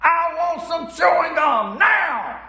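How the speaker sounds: high, slow, drawn-out tantrum scream; child's scream. Acted. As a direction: scream it like a kid throwing a fit at checkout. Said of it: "screams at the top of her lungs".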